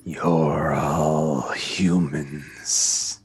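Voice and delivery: very slow, low creature growl; deep monster pronouncement. Acted. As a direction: rumble each word out, drawn long. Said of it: "he growls once more and just".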